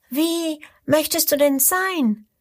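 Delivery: the little crocodile asks, curious and small. A narrator's reading. Little crocodile asks the big one, small high voice. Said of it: "fragt das kleine Krokodil zurück".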